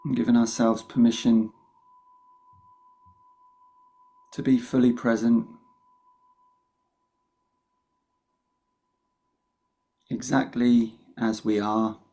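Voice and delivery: calm meditation guide with long pauses; guided meditation. Real, not acted. Meditation teacher opening a session for the group, unhurried guidance with long pauses. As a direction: lead them gently in, leaving long silences between phrases.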